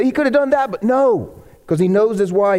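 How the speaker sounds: ordinary delivery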